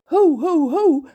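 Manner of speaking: high, loud calming call. Acted. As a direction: rein them in like horses. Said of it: "roept Reus". (luid roepen om te sussen)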